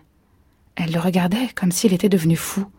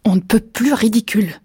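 mildly raised, heated voice; almost yelling; 3 semitones higher than usual. A narrator's reading. Husband mocking wife's dream, almost yelling. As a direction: snap back, short of a yell. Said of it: "il hurlait presque".

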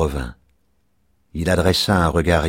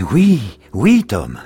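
high, louder double yes, dropping on the name; eager assurance; 6 semitones higher, 7 dB louder, much slower than usual. A narrator's reading. Old father assures his returned son, eagerly. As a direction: reassure with a high, emphatic burst. Child anxious, father reassures him.

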